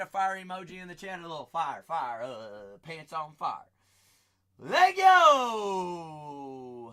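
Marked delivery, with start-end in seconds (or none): 4.6-6.9 hype yell. Real, not acted. Hype call on the stream.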